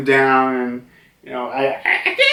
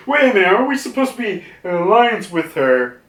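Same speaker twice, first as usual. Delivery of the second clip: higher, quick acted protest; mock-startled objection, rattled off; confused protest; 6 semitones higher, faster than usual. Pirates question the alliance, confused protest.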